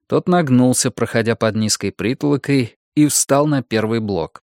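ordinary delivery

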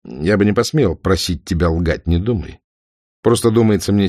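plain reading voice

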